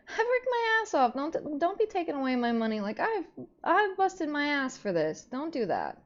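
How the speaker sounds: high, indignant venting about being shorted; exasperated rant. Real, not acted. Streamer venting to chat about her missing pay, exasperated and high. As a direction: push it up high, fed up and wronged.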